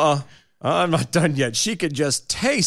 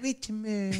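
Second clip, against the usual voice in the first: echo effect; 8 semitones higher, 11 dB quieter than usual. Acted. Echo of the name.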